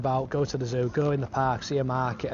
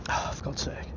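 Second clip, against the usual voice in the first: muttered; 10 dB quieter than usual. Real, not acted. Sudden annoyance in park.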